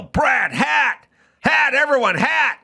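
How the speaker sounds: high-pitched yell across the room, not full volume; retold yell. Yelling to everyone that the hat commercial is on, high retold yell. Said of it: "And I yell".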